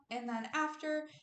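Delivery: usual speaking voice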